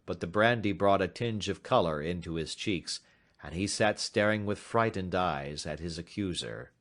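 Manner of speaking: ordinary delivery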